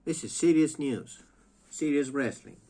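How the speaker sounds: mock gravity. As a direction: proclaim it with fake importance. Mock serious announcement.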